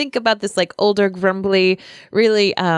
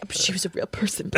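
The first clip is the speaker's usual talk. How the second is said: whispering; 9 dB quieter, faster than usual. Acted. Defending a minor character.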